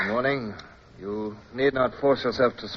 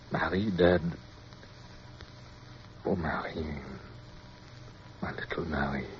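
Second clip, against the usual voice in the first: hushed grief; 4 semitones lower, 13 dB quieter than usual. Acted. Mourning his dead love alone.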